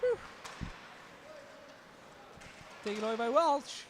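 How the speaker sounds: words through a yawn. Real, not acted. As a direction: talk while stifling a yawn. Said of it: "sorry for yawning".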